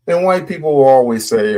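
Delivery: ordinary delivery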